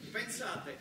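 normal talking voice

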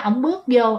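plain reading voice

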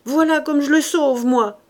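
loud, high self-reproaching cry. A narrator's reading. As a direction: cry out against yourself. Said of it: "il cria".

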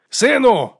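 high, loud call to his son; father shouting. A narrator's reading. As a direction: shout for your son over screams and noise. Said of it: "Його батько тепер кричав". (батько кричить синові крізь галас)

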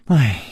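short falling sigh. A narrator's reading. Yinji regretting Chu Liuxiang's folly, falling sigh. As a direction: sigh on a dropping pitch. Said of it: "银姬叹了一口气".

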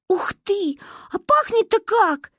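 put-on high, slow marvelling child; girl sniffing cake in delight. A narrator's reading. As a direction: savour the smell aloud.

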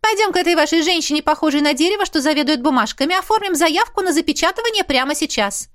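high, excited chatty mother; mother gushing. Acted. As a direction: gush like a thrilled mother. Mother insisting. (восторженная мама, высокий голос)

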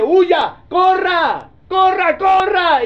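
high, loud, urgent cries to run; the devil urging. Acted. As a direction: fire off each word high and loud, urging flight. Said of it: "que el diablo le dice". (el diablo apremiando a gritos agudos)

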